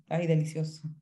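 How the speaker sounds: normal talking voice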